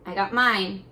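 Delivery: usual speaking voice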